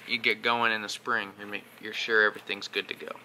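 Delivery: normal talking voice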